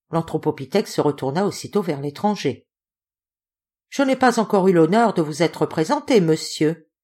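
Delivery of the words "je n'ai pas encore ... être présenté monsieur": ordinary delivery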